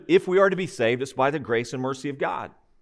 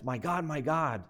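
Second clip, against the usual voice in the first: slow, much softer quotation; hushed quoted lament; 10 dB quieter than usual. Retold or imitated. Preacher quoting the suffering Christ to the Father, hushed and slow.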